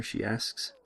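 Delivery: ordinary delivery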